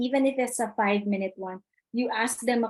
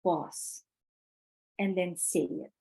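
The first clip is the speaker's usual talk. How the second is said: soft pause before and then say it; soft, quiet demo of pausing; demonstrated pause; 3 semitones lower, 6 dB quieter than usual. Shown as a demonstration. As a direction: leave a real gap after pause. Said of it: "When you want to say something important".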